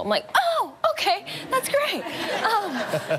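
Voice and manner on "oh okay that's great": very high, nervous fake delight; forced cheerful. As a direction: pretend it's great while panicking. Retold fake-cheerful reaction.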